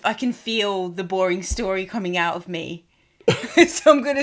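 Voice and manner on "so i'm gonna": laughing. Real, not acted. Laughing at herself.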